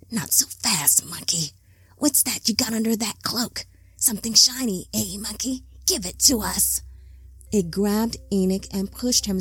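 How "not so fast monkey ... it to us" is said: sly creature whisper, half to itself. Acted. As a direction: hiss it softly like a lurking creature. Creature taunting Enoch.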